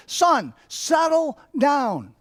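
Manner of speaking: high, slow, drawn-out telling-off; stern scolding. Retold or imitated. Dad to his energetic five-year-old, stern scolding. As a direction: order a child firmly, stretching the words. Retold scolding of young son.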